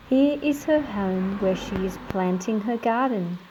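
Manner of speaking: plain reading voice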